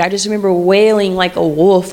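ordinary talk

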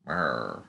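low grumbling growl. Retold or imitated. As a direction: grumble it like a surly bard. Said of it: "That's my Tom sound effect".

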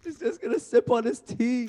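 high, put-on teasing voice; teasing mimicry. Co-host mocking the host sipping, high teasing voice. Mocks host's announced sipping.

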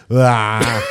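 mock moan breaking off a complaint; imitated sick moan. Wife told to stop doing it, mimicked moaning protest. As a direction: groan "ah" in imitation. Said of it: "if I start telling her stop doing it".

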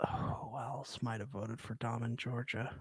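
soft, breathy whispered question. Real, not acted. Cohost whispers a gossip question about the voters, soft whisper. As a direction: whisper it conspiratorially.

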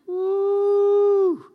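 long, high "woo"; loud, drawn-out whoop; reacting whoop. Real, not acted. Preacher reacts to the man's bold retort, whooping.

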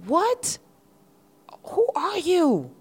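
stunned, asking who would dare; high, loud incredulous challenge; incredulous outrage. Real, not acted. Outraged at colonizer mindset.

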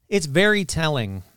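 usual speaking voice

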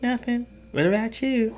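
high-pitched mock texting reply; put-on high voice, imitating her; imitating a female texter. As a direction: lift it high for her side of the chat. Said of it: "she's gonna be like".

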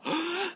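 sharp gasp in; big initial gasp. Shown as a demonstration. Runner contrasting her first inhale, sharp gasp. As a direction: grab the air in one quick gasp. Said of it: "that big initial".

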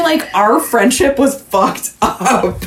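slow, laughing confession. Real, not acted.

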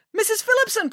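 high-pitched concerned call. Acted. Friday to Missus Phillipson across the room, calling out. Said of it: "called Friday".

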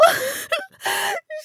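acted hysterical sob; high breaking sob. A narrator's reading. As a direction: burst into high-pitched sobbing. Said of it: "she began to sob".

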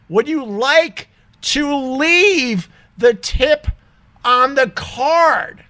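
very slow, high-pitched question; exaggerated mocking quote. Repeating the cashier's tip question, mockingly exaggerated. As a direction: stretch every word out in mock disbelief.

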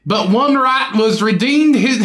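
shaky attempt at a British accent, close to laughing; amateur British impression; mock British accent. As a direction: put on a clumsy British accent. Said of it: "do your British accent for this one".

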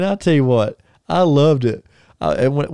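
ordinary delivery

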